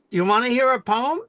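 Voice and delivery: quick, loud, high eager offer; eager question. Retold or imitated. Friend eagerly offers a poem.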